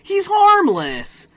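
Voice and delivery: high, loud, slow yell; mock Jimmy shout. Imitating Jimmy snapping out of the fit, high and loud. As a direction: bellow it with sudden cartoon urgency. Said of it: "he's like".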